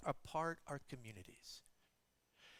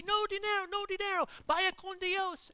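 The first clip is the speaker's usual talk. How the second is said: lightly voiced laborer, higher, slowing at the end; friendly refusal; 12 semitones higher, 5 dB louder than usual. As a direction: wave off the money kindly.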